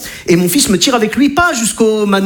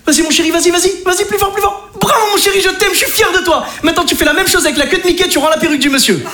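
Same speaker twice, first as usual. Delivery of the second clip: much higher, frantic dad cheering on his son; excited cheering; 9 semitones higher than usual. Retold or imitated. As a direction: cheer him on like it's the final. Papa hurlant d'encouragement au manège. (papa surexcité qui encourage son fils, voix très aiguë)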